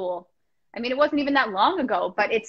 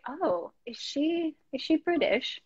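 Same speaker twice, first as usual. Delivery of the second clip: slow, higher, softer mock curiosity; imitated wondering; 4 semitones higher, 6 dB quieter, slower than usual. Listeners puzzling over where she studied, imitated wondering. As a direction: wonder aloud slowly, as an intrigued listener would.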